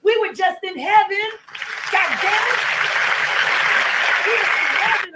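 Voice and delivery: usual speaking voice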